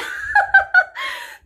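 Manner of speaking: loud high burst of laughter. Real, not acted. Speaker laughing while retelling the story, high and loud. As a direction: laugh out at the memory.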